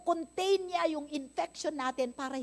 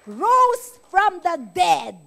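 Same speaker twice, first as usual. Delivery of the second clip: slow, loud triumphant proclamation; proclaiming; 3 semitones higher, 12 dB louder, much slower than usual. Real, not acted. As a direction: announce the miracle to the church. Preacher's triumphant declaration. (malakas at mabagal na pagpapahayag)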